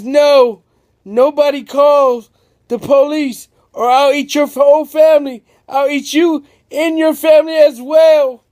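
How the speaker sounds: high loud threatening roar; monster yell. Acted. Big Neat threatens to eat everyone's family, yelling. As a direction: bellow the threat at the crowd. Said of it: "yells and says".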